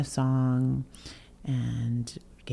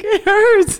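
much higher, louder crying complaint; 10 dB louder than usual. Retold or imitated. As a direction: wail it out, half laughing at herself. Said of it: "I was crying".

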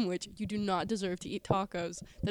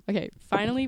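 normal talking voice

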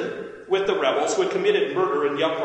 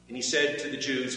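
ordinary delivery, nothing marked

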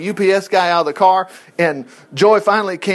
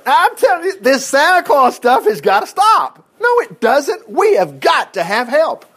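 hammed-up wife laying down the law; mock nagging wife; 7 semitones higher than usual. Wife's voice acted in a sermon.

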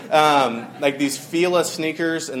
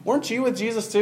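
lightly voiced little girl, rising high on the question; little servant girl; 8 semitones higher than usual. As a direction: imitate a curious girl calling someone out. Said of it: "a little servant girl comes up".

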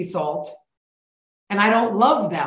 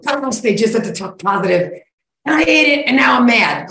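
louder and higher, mock annoyed complaint; rising exasperation, hammed-up; mock frustrated rant; 5 semitones higher, 6 dB louder than usual. Listener rants after eating sugar, mock angry and loud. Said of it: "and go".

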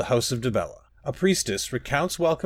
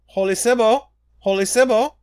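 higher, louder excited call; urgent calling; 10 semitones higher, 7 dB louder than usual. Acted. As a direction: call her eagerly across the garden.

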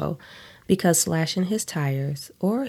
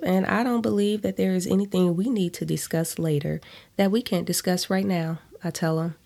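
ordinary delivery, nothing marked

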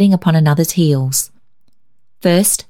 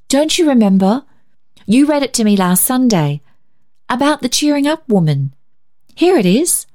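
put-on higher, eager girl's voice; a hint of a little invalid girl excitedly pointing something out; young sister showing the magazine page, lightly voiced; 7 semitones higher than usual. A narrator's reading. Muriel voiced, eager reminder.

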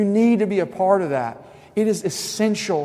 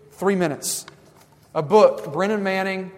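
ordinary delivery

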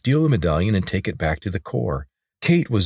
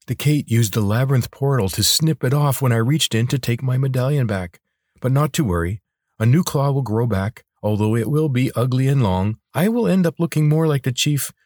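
lightly voiced creature, slightly higher; matter-of-fact lizard; 6 semitones higher than usual. Lizard tells Corvin a claw will regrow, lightly voiced. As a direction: reassure a master as a loyal little lizard.

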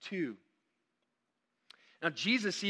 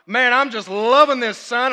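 put-on strong Southern accent; 6 semitones higher, 12 dB louder than usual. Teenager on the roof to his youth pastor, strong Southern accent. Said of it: "never heard such strong accents".

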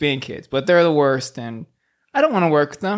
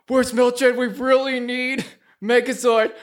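higher put-on voice; worried teammate; 8 semitones higher than usual. A Ranger teammate wondering where Mildred is, higher voice. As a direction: call for backup anxiously.

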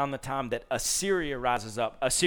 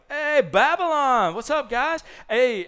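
much higher, loud, eager hey; eager greeting; 11 semitones higher, 11 dB louder than usual. Retold or imitated. Hezekiah eagerly greeting the Babylonian messengers, loud and high. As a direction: greet them like long-lost buddies. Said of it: "he's like".

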